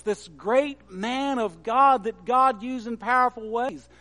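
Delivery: ordinary talk, nothing marked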